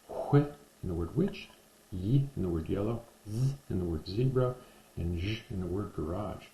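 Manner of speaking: each sound said alone, then the word; phoneme drill. Shown as a demonstration. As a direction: clear teacher demo of English sounds. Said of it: "giving you the forty-two sounds".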